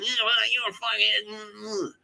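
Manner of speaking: high, slow whiny imitation; hammed-up mimicry of endless nagging; mocking ranter. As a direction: drag it out in a high caricature voice. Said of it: "he was still going".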